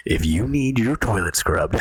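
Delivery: deep dramatic trailer announcer; movie trailer voice. Pretend. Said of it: "movie trailer voice on and be like".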